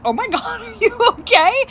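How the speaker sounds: high-pitched question through laughter; amused, barely holding it together; bursting out laughing. Retold or imitated. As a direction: laugh while asking after someone. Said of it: "I burst out laughing".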